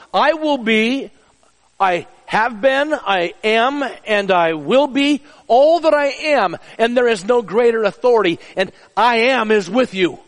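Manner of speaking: slow preached declaration; preaching climax. Acted. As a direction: proclaim it slowly, building with each line. Preaching God's answer to Moses.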